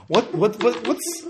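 high, startled "What? What?"; confused superhero. Acted. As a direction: blurt it out, baffled. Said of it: "They ask you".

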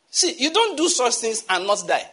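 ordinary talk

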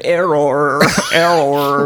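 stuttering machine voice repeating error; glitching robot. Pretend. Voicing the Mangler machine glitching.

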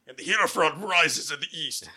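loud, grand mystical reading; dramatic reading voice. Pretend. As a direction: intone it like an ominous prophecy. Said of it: "You really transitioned through that reading".

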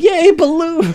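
high squeal of delight; excited cheer. Retold or imitated. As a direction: cheer like a kid at a fair. People cheering for balloons.

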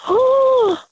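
sharp high gasp of shock. Real, not acted. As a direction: suck in air, stunned. Shocked by the ID story.

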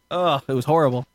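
ordinary talk

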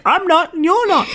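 high squeaky mimicry; squeaky mock voice. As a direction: squeak it like a cartoon. Mimicking the characters' dialogue.

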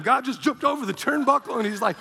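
usual speaking voice

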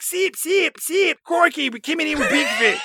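high, far-off shouted chant; Thomas Lennon war cry. Retold or imitated. Recapping the movie for his cohost, a high shouted war cry. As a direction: yelp it out like a battle cry from a truck top. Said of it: "that's just his war cry".

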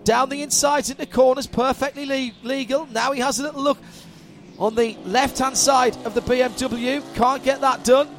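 rising, fast race call; excited play-by-play. Real, not acted. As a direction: call the overtake live. Live play-by-play.

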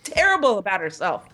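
high, pushy emcee egging him on; high, urging, mock-indignant; hammed-up host. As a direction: goad him like a game-show host. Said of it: "the M C goes".

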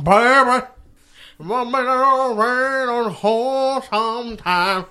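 slow, high, loud crooning line; hammed-up lover's croon; mock smooth talker. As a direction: ham up a smooth pickup line. Mock seductive quote to friends.

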